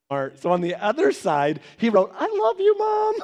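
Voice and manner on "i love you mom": put-on sweet kid voice. Brother's note read out.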